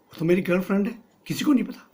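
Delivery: startled outburst. Acted. Hero startled that the director knows his girlfriend. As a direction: blurt it out in surprise.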